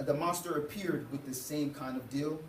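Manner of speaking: usual speaking voice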